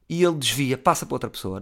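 ordinary talk, nothing marked

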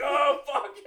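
high, loud exclamation with laughter; mock shocked outcry. Imitating a character after the killing, mock shock. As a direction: blurt it in exaggerated alarm.

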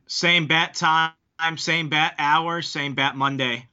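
slow, drawn-out TV Batman catchphrase delivery; Adam West. Acted. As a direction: stretch each phrase out like a campy hero narrator. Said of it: "I just saw a commercial with Adam West".

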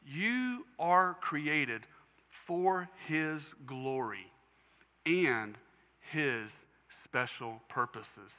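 very slow, deliberate reading; drawn out word by word; read slowly. Shown as a demonstration. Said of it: "I'm gonna read this slowly".

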